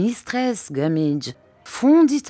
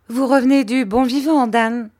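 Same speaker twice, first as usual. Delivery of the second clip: plaintive reproach; 8 semitones higher, 6 dB louder than usual. A narrator's reading. Widow reproaches Dan.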